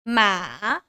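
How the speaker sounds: MA dipping, then rising; third tone. Shown as a demonstration. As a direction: take "ma" down and back up. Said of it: "this is called the third tone".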